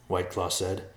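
usual speaking voice

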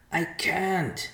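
high plea fading to a thin whisper; begging vulture. A narrator's reading. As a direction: beg helplessly in the bird's high voice. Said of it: "the vulture was begging".

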